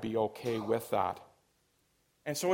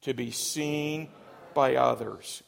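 slow, emphatic conviction. Shown as a demonstration. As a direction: say it like you mean it, slow on "seen". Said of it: "Say it again with conviction".

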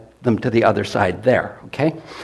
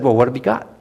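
preacher telling a Bible story to the congregation; lively Bible story retelling; sermon storytelling; faster than usual. Acted. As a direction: tell it like a story from the pulpit.

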